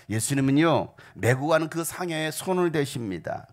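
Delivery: usual speaking voice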